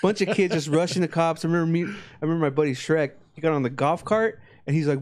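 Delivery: ordinary delivery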